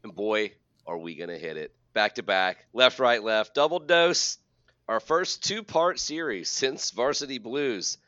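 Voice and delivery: big host voice, lifted on key words; show-opening announcer. Acted. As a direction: hype up the episode like a host. Hosts the show's opening.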